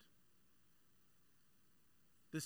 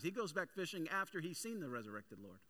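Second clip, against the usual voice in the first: normal talking voice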